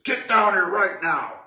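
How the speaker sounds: dad's mock shout, slow and loud; retold yell. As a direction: bark it out like an angry dad calling his son downstairs. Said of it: "he's yelling and screaming for me".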